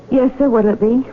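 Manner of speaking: normal talking voice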